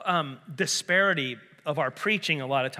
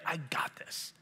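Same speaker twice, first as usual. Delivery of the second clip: breathy whisper, no voice; 11 dB quieter, slower than usual. Acted. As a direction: whisper it like a secret promise. Jesus voiced as reassuring quote.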